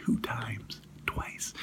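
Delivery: whispered aside, very soft; confessional whisper. Pretend. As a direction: whisper it like a guilty little secret. Confessing pizza binge in an aside.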